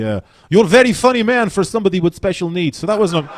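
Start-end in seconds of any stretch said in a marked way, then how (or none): 0.5-2.8 higher mock Russian compliment; lightly voiced Russian accent; Russian girl. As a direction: play a sweet Russian fan. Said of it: "she said to me".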